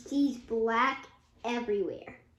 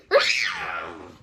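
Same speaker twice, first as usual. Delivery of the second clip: evil cackle; 7 dB louder than usual. Acted. Villain after threat.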